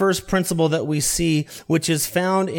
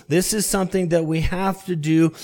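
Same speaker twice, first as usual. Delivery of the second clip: normal talking voice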